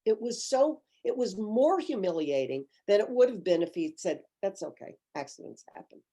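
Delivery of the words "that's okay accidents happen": soft, trailing-off imitated reply; understated reassurance. Imagined busboy excusing spilled water, softly reassuring. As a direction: play down the mishap quietly.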